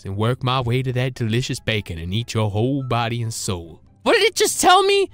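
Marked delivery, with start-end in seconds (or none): 0.0-3.8 very low, quiet, menacing creature voice; monster voice. Acted. Ugly dog whispering threats to eat the boy, low and menacing. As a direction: purr it like a hungry monster.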